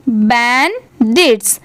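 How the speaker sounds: बैंडिट्स very slowly as बैन, pause, डिट्स; slow teacher's breakdown of बैंडिट्स; syllable by syllable. Shown as a demonstration. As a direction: split बैंडिट्स into two separate parts. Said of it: "क्या हो जाएगा".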